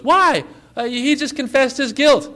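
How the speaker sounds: loud high "why", then baffled objection; incredulous protest. Acted. Guard questions the king's release order, incredulous protest.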